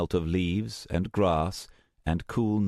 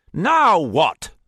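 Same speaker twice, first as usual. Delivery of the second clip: louder, higher exasperated demand; irritated shout; 11 semitones higher, 9 dB louder than usual. Acted. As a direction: bark at her, fed up. Almost ready to kill Luna.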